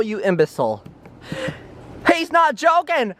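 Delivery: usual speaking voice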